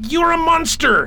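angry shout. Acted. Jim yells at the killer who betrayed him, a furious shout. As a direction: shout it high and loud in fury.

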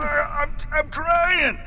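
high, effortful yell from underneath; strained shout. Acted. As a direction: cry it out while pinned down. Pinned under the outlaw.

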